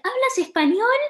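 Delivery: put-on high, loud excited little kid; excited kid asking. Little kid amazed to hear Spanish, high excited question. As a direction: squeal it out like an amazed child. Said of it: "and he was like".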